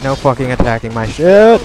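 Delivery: frustrated gamer outburst; frantic shout. Real, not acted. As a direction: yell in alarm as your base is attacked. Units under attack in game.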